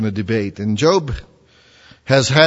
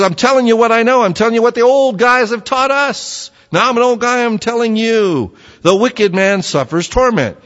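put-on higher and louder, a puffed-up old man insisting; smug Eliphaz; 10 semitones higher, 4 dB louder than usual. Preacher voicing Eliphaz boasting of his age to Job, higher and louder know-it-all. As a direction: hammer it home like a self-satisfied elder. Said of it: "He says".

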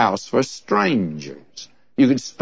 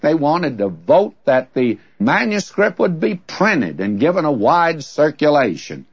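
ordinary delivery

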